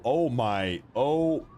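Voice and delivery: ordinary talk, nothing marked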